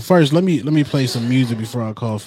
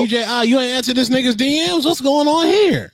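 mock shock; 10 semitones higher than usual. Host stirs up mock drama.

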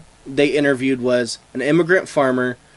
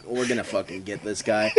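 low, softer, laughing conspiratorial line; scheming aliens; 7 semitones lower, 9 dB quieter than usual. Acted. Aliens plotting to prank Carl, low and laughing. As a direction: plot it low while laughing.

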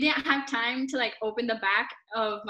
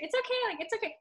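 much higher, softer soothing voice; retold reassurance; 11 semitones higher, 4 dB quieter, faster than usual. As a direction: imitate a mother brushing off an apology. Voices mother reassuring the apologizer.

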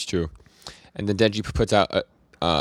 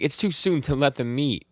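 mild mock yell, pitched higher; retold yelling; 6 semitones higher than usual. Yoshida yelling at the guy supervising, mild mock yell. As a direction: scold a subordinate in a half-hearted imitated yell. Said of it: "Yoshida's yelling at this guy".

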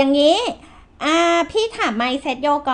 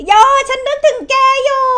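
excited greeting; 12 semitones higher, 9 dB louder than usual. Real, not acted. Hosts greet guest on air.

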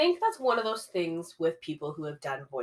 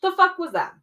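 much higher, louder outburst of disbelief; outraged exclamation; 11 semitones higher, 7 dB louder than usual. Real, not acted. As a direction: blurt it out, loud and high. Repeats her own indignant line.

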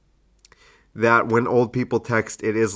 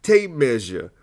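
Steve Harvey; 6 semitones higher than usual. Shown as a demonstration. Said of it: "I was gonna do a Family Feud thing".

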